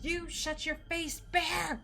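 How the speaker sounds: drawn-out, high-pitched mock scolding; mock angry yell. Streamer telling off a chatter called Bear, mock angry yell. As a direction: playfully yell it, stretching the words.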